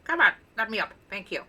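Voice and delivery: usual speaking voice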